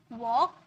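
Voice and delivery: वॉक with lips rounded into a W; वॉक with a clear W. Shown as a demonstration. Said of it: "मुंह round हो रहा है".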